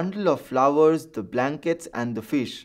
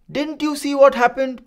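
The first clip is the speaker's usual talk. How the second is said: put-on high, loud, accusing shout at the boy; a red-faced man yelling his point; 10 semitones higher, 6 dB louder than usual. A narrator's reading. A shouting man demands of Farid, loud and high. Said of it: "The shouting man".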